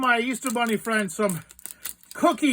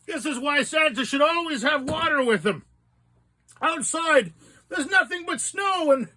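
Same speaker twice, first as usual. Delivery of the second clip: high strained voice after sour candy; strained by sourness; 7 semitones higher than usual. Real, not acted. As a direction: talk high through the sour burn. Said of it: "I'm going to need some water".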